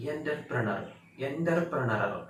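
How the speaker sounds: एंटरप्रेन्योर starting "yen-ter"; wrong pronunciation. Shown as a demonstration. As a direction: say it the mistaken "EN-ter-pre-nar" way. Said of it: "गलत हो गया". (एंटरप्रेन्योर का गलत उच्चारण, "एंटर" से शुरू)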